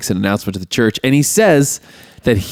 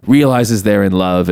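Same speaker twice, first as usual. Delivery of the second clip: ordinary talk, nothing marked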